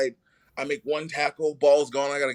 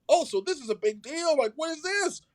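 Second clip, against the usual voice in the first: rising incredulous exclamation; surprised disbelief. Retold or imitated. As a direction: pitch climbing much higher in mock amazement. Retelling his surprise at the event.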